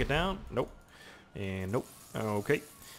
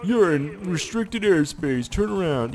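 slow, higher mock announcement; official warning voice; 6 semitones higher, 5 dB louder, slower than usual. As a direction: read it like a stern dispatcher. Voicing the game's warning.

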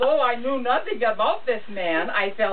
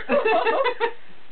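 squealing laugh. Real, not acted. Laughing while reading story.

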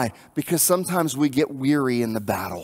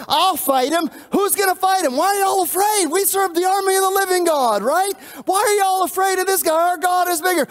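much higher, bold and eager, urging the army; a shepherd boy daring the scared army, high and bold; eager young David; 3 dB louder than usual. Retold or imitated. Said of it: "David is like".

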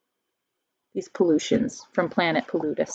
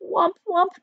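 high, panicky little wump cries; creature cry; 11 semitones higher than usual. Acted. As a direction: squeak wump wump like frightened creatures. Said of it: "they cried".

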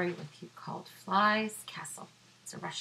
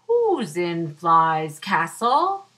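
loud, slow lumbering bear; big bear voice; 13 dB louder, slower than usual. Acted. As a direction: call out slowly like a huge bear.

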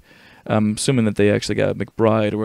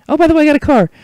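much higher, louder put-on voice; high-pitched character; 9 dB louder, much faster than usual. Game sidekick announcing a car, high excited voice. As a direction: pipe up high and loud.

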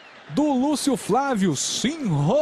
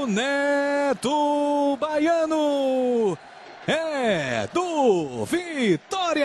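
long stretched goal cry, higher; drawn-out goal call; 4 semitones higher, much slower than usual. Real, not acted. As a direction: stretch every syllable of the goal.

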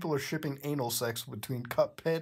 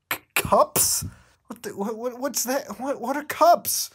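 high, breathy-stuttered mock whimper, rising to louder; a confused squeaky Bendy, getting louder; 9 semitones higher, 6 dB louder than usual. Reader voicing Bendy confused by the typo, high mock whimper. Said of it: "he whimpered out".

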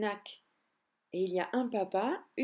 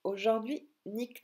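usual speaking voice

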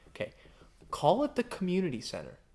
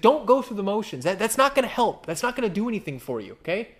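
emphatic warning; 5 semitones higher, 4 dB louder than usual. Acted. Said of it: "He's like".

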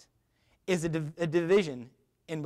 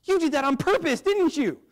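accusing roommate; 7 semitones higher, 5 dB louder than usual. Retold or imitated. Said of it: "he looks at me and goes".

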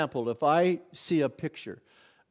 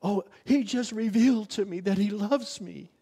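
high, loud mock testimony voice; imitating churchy testimony; 6 semitones higher, 5 dB louder than usual. Preacher imitates Christians describing a revelation, mock churchy. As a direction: lightly ham up a churchy revelation. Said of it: "you'll hear Christians talk like that".

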